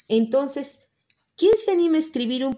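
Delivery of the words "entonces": usual speaking voice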